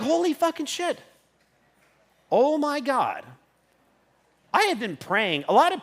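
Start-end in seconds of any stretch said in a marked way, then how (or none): 0.0-3.2 high, awed exclamation; high, awestruck swear; amazed. Retold or imitated. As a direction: let it out in disbelief. Retold amazement, set against later repeat.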